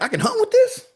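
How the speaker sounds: squeaky high, stunned question; amazed disbelief. Retold or imitated. To the shop guy, learning he can hunt with it: high amazed squeak. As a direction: push the pitch way up in disbelief.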